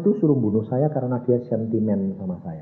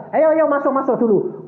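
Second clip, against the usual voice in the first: high, hurried invitation; acted eager welcome; urgent beckoning; 13 semitones higher, 4 dB louder than usual. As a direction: usher them in eagerly, pitched up. Acted urging visitors inside.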